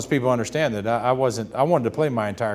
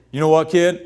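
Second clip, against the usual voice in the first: louder, higher, self-assured; big booming voice; 5 semitones higher, 8 dB louder than usual. Retold or imitated. Ted Williams lecturing the young pitcher, booming. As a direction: boom it at the kid. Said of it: "I hear a big boom of voice".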